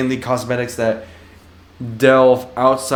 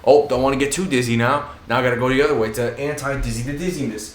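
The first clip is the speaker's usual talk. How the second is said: usual speaking voice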